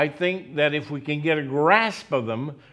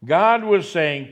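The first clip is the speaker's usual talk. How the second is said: normal talking voice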